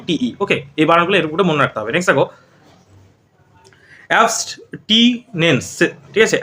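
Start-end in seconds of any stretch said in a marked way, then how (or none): 4.1-5.7 "অ্যাবস্টিনেন্স" very slow, broken into syllable chunks; slow model pronunciation. Shown as a demonstration. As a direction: sound out "অ্যাবস্টিনেন্স" piece by piece, with gaps. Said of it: "এরকম উচ্চারণ হবে".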